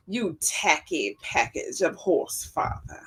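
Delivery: hissed whispered insult; whispered lion insult. Acted. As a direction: breathe the insult under your breath. Lion's insult.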